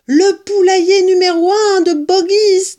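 high, loud, elated announcement; triumphant exclamation. A narrator's reading. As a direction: proclaim the prize at full voice. Fox triumphs at reaching henhouse.

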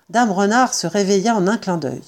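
ordinary delivery, nothing marked

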